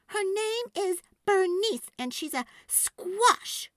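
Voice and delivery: put-on little girl voice. A narrator's reading. Girl answering rude kids, high and firm. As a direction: stand up for her friend. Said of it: "Sophie said".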